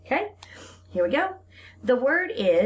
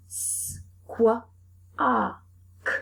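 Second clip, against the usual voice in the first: sounding out; 3 semitones higher, slower than usual. Shown as a demonstration. Teacher segmenting a word for the class. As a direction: split into separate sounds. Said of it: "how many sounds are in this word".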